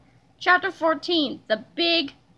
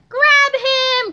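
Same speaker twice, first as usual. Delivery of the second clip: high, loud command; urgent shout; 9 semitones higher, 8 dB louder than usual. A narrator's reading. George urging Harold on, shouting. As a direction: shout to a friend to act. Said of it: "cried George".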